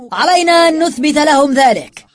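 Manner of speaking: high, sustained rallying shout; loud address. A narrator's reading. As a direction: rouse the crowd at full voice. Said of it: "تحدث بصوت عال إلى العمال".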